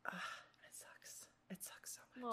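breathy, very soft and slow; real quiet lament; whispered sadness. Real, not acted. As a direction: sigh it out under your breath. Missing her best friend abroad.

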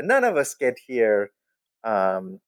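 normal talking voice

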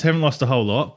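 ordinary talk

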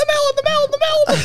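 very high, squeaky chant; squeaky cartoon bird. Quoting Ice Age birds.

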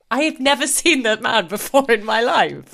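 emphatic disbelief. Retold or imitated. Viewer shown pictures of a stranger, in emphatic disbelief. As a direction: insist it with hammed-up astonishment.

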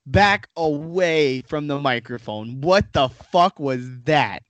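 slow, drawn-out outraged demand; appalled disbelief. Real, not acted. Host scolding co-host for awful accent, appalled disbelief. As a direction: stretch each word in exasperated disbelief.

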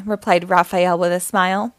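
ordinary delivery

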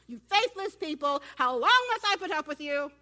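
loud mock rebuke from above; hammed-up exasperated shout; exasperated Jesus. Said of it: "looking down at me like".

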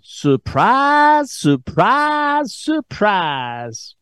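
mock Southern accent like a TV bumpkin; Gomer Pyle Southern accent. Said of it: "phony Southern accent, like Gomer Pyle".

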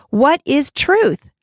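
slow, drawn-out sarcastic question; a lightly voiced weary governor, mocking; sarcastic Pontius Pilate. Teacher voicing Pilate questioning Jesus, slow and sarcastic. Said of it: "asked him rather sarcastically".

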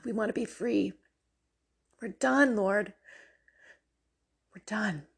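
earnest spoken prayer, rising to fervent pleas. Acted. Leading the viewers in prayer against sin, fervent prayer voice. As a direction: pray aloud with growing fervour, some lines dropping to a whisper.

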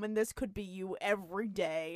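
ordinary talk

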